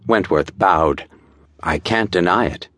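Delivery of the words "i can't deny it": plain reading voice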